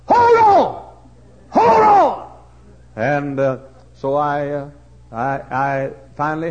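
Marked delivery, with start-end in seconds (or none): 0.1-2.3 loud urgent shout. Retold or imitated. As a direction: yell up to him. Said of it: "And the fellow said".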